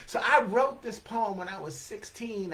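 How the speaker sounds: ordinary talk, nothing marked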